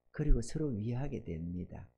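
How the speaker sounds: ordinary talk, nothing marked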